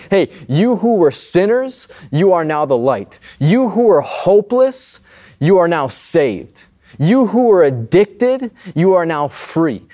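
slow, rising preacher build on each "you who were"; preaching Jesus' words. Acted. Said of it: "He's saying".

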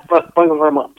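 a playful hint of a famous crooner's thank-you. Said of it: "In my Elvis voice".

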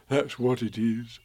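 a touch of a slow teddy bear voice; gentle bear asking a friend; mild Pooh voice; lightly voiced Pooh bear.

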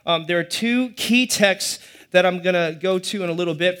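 ordinary delivery

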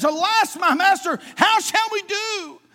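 high, loud alarmed cry; cries out. Acted. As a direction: cry out in panic. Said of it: "he just cries out".

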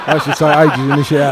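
cracking up at his own innuendo; laughing. Real, not acted. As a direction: laugh helplessly while repeating it. Laughing at innuendo of long showers.

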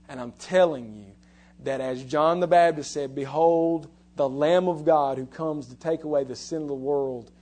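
loud, ringing pulpit proclamation; preaching at full force. Acted. Preacher to congregation, loud full-force preaching.

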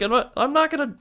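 over-the-top Australian impression of an angry wildlife host; terrible Australian accent. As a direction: ham up a bad Aussie accent, pitched high. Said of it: "That's my terrible Australian accent".

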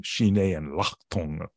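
put-on slow, Tibetan-accented teacher's voice; a light impression of an old Tibetan lama, slow; Nakchang Rinpoche's voice. Recalling his Tibetan teacher, a slow impression with a Tibetan accent. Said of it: "in, uh, Nakchang Rinpoche's voice".

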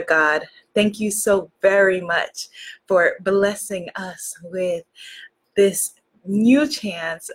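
devotional opening prayer, low and unhurried; heartfelt prayer. Real, not acted.

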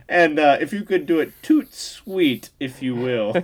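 hammed-up genteel request; mock proper customer. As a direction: linger daintily over the words like a pretend aristocrat. Parodying over-proper speech at gas station.

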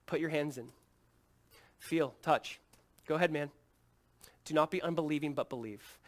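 gentle invitation with unhurried pauses; loving, patient. Acted. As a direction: coax a doubter tenderly, voice settling down. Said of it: "It's so loving. It's so grace-filled. It's so patient".

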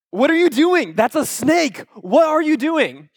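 high-pitched, hammed-up freak-out; mock panic. Five-year-old protests as dad holds snake, mock panic.

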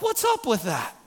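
high, loud mock outrage at the kids; hammed-up exasperated dad; half-joking indignant father; ticked-off rant. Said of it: "I'm a little ticked about that".

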